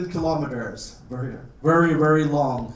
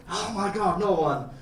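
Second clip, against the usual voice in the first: usual speaking voice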